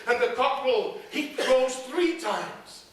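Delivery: ordinary delivery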